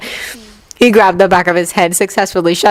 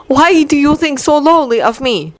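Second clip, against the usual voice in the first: hurt; 7 semitones higher than usual. A narrator's reading. Victor hurt.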